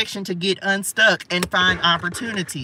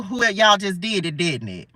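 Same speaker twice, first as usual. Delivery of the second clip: usual speaking voice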